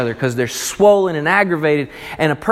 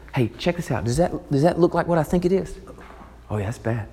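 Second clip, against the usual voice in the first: hushed gossip; 6 dB quieter, faster than usual. Acted. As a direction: whisper like neighbours eyeing a rash. Villagers whispering about sores.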